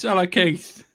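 ordinary delivery

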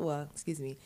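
usual speaking voice

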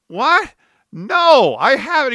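a hammed-up baffled "what?", voice jumping up; incredulous. His retold incredulous reply.

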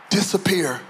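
ordinary talk, nothing marked

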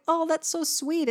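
high, cooing listener reaction; mock swooning. Teacher plays a charmed listener, lightly cooing. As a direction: gush over how sweet it is. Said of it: "And you're like".

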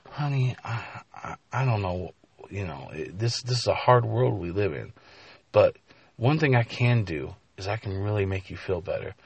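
quiet, hesitant consoling voice; soft consoling. Acted. Professor offering comfort to a fired woman, quiet and hesitant. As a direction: speak softly, feeling for the words.

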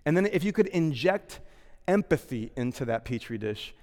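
ordinary talk

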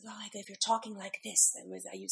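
breathy, airy, half-whispered. Shown as a demonstration. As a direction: speak on a soft airy breath. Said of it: "like an airy voice".

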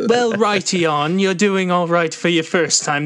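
a hint of a stiff British professor; British accent. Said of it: "That's my Milo impression now".

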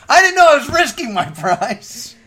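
high, loud outraged protest; indignant protest. Real, not acted. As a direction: protest the unfair rule. Protesting the new game rule.

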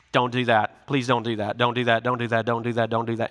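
low, soft, relentless repeated plea; a low, quiet voice pleading again and again; the Spirit's quiet, insistent warning; nagging inner voice. Retold or imitated. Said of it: "you even hear it like that".

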